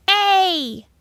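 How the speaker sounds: put-on high, loud child's call; eager schoolgirl shout, pitched high. A narrator's reading. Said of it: "Ruthie Lawton shouted".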